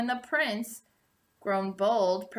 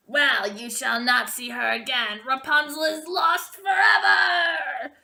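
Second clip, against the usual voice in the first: cruel triumphant sorceress; 4 semitones higher, 6 dB louder than usual. A narrator's reading. Sorceress telling the prince Rapunzel is lost, cruelly triumphant. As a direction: crow it out cruelly. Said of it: "she cried".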